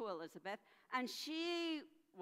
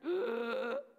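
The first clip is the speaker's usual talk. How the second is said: high loud gasp of fear; loud frightened gasp; scared gasp; 6 semitones higher, 6 dB louder than usual. Acted. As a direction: gasp in alarm. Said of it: "she looked really scared again".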